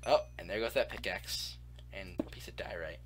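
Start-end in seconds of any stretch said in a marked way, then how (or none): 1.9-3.0 whispering. Real, not acted. Muttering aside while mining.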